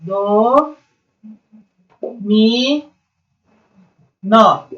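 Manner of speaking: "Do, mi, nó" split by long pauses; separated syllables. Shown as a demonstration. Teacher showing how to separate into syllables, "Do, mi, nó" piece by piece. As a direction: stretch "Do" and "mi", then land on "nó". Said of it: "você vai separar em sílaba".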